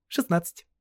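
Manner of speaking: put-on high, girlish interjection; quick correction. A narrator's reading. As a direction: put him right at once in a girl's voice. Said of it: "быстро уточнила она". (быстрое девичье уточнение)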